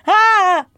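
high, loud yelp of pretend fright; short hammed-up shriek; mock scream. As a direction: yelp as if playfully terrified. Mock terror of co-host.

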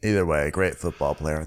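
usual speaking voice

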